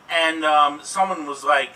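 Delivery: usual speaking voice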